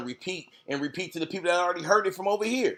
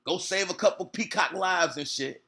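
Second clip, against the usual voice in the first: ordinary delivery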